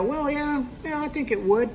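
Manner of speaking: unsure customer. Retold or imitated. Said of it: "they'll give you the".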